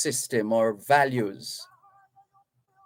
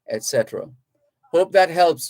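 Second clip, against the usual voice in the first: normal talking voice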